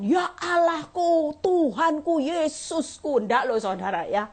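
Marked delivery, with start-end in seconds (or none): 0.0-3.2 mock flowery prayer. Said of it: "wah, bahasa kiasan".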